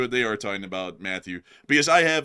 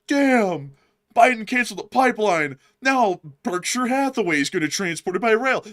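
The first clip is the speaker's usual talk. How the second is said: higher, louder mock outrage; hammed-up indignant rant; mock frustrated grumble; 10 semitones higher, 6 dB louder than usual. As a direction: act out people annoyed at the news. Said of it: "a lot of people were like".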